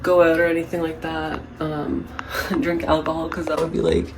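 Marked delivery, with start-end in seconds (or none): none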